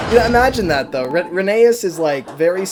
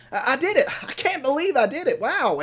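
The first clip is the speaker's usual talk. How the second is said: high-pitched, acted giddy triumph; amazed delight; 11 semitones higher than usual. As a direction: play thrilled disbelief at your own success. Madge celebrates finishing the job.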